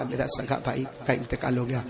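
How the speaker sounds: soft, low, broken grief; weeping. Retold or imitated. Brother telling friend of his death, soft weeping. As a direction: say it quietly as if crying. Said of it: "روتے روتے کہا".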